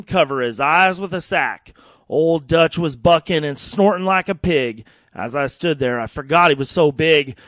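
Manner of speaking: lively storytelling cowboy poem; cowboy poem recitation. Acted. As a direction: recite it like a campfire yarn.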